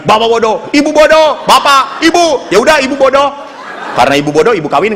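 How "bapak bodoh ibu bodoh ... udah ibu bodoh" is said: quick, raised mock bickering; parents' quarrel. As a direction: trade insults higher and louder, back and forth. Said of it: "Lalu mereka bapak berkelahi". (menirukan orang tua bertengkar)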